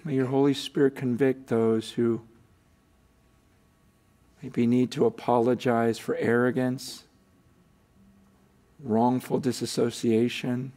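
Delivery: closing prayer. Acted.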